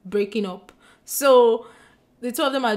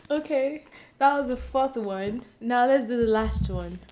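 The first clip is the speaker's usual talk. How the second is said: normal talking voice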